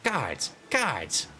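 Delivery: high, indignant shouting noble; outraged father. Acted. Angry father shouting at the stranger, calling guards. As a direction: splutter and call the guards.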